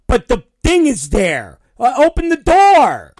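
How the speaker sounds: loud, high-pitched outburst of disbelief; exasperated insistence. Retold or imitated. Passenger begging agent to open the door, exasperated. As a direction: build to an incredulous near-shout.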